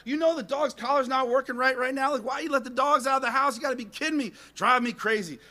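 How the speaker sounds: high-pitched exasperated scolding; retold fed-up complaint; frustrated. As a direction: rant in acted-out disbelief. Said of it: "I'm all frustrated".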